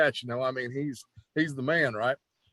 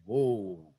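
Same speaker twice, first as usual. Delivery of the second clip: low, drawn-out amazed whoa; stunned whoa; 7 semitones lower, 3 dB quieter than usual. Retold or imitated. Told he'll work with Flair, a low amazed whoa. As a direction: let the surprise drop low in the voice. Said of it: "And I was like".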